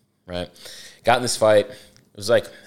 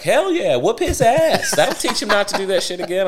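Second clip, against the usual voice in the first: very high, fired-up mom voice; gung-ho approval; 11 semitones higher than usual. Retold or imitated. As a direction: egg them on as the eager mother. Mom cheering on the paddling, retold.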